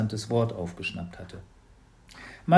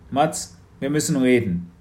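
a hint of a serious father; stern father, a bit louder; Papa, lightly voiced; 2 semitones higher, 6 dB louder than usual. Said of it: "sagte Papa". (ernster Vater, etwas lauter)

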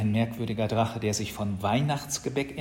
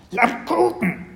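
put-on high, loud baby-dragon squawk; squeaky creature voice; little creature calling; 12 semitones higher, 7 dB louder than usual. A narrator's reading. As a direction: cry out like a tiny animal demanding a treat. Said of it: "rief das Tierchen wieder".

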